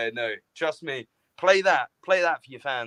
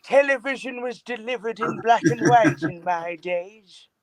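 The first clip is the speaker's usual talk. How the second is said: higher-pitched mock old-timer; old-timer act; 7 semitones higher, 3 dB louder than usual. Old-timer joking to co-host about ancient television, mock elderly. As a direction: reminisce like a creaky old-timer, lightly hammed.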